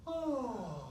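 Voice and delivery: high, falling sigh on "oh"; sighed "oh", sliding down. Shown as a demonstration. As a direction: let out a loud sigh from up high. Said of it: "and sigh".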